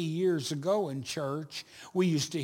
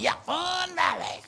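enthusiastic church member; 11 semitones higher than usual. Retold or imitated. Church member insisting to a doubtful pastor, excited. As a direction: gush it like an overeager fan.

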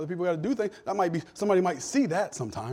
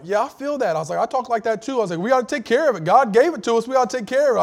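higher, louder teasing preacher voice; mock sermon; 7 semitones higher, 8 dB louder, faster than usual. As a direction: preach at her like a jerk, tongue in cheek. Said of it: "I start preaching a sermon".

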